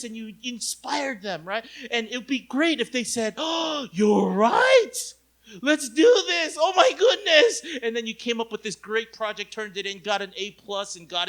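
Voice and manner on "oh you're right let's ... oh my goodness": hammed-up loud eager cheer; mock rapture; excited team. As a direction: burst out in delighted agreement, laughing. Imagined team cheering with laugh.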